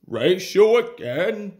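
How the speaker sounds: loud little-engine challenge; huffing challenge. A narrator's reading. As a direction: huff it out loudly. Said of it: "huffed Scarlurry".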